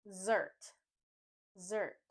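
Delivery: Zert alone, with a z sound; stressed syllable alone. Shown as a demonstration. Teacher drilling the second syllable, a firm Zert. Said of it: "The stress is on the second syllable".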